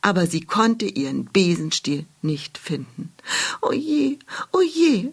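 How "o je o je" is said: high, sighing o je. Acted. Lost witch sighing at the foot of an oak. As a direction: let it out as a high little sigh. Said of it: "seufzte sie".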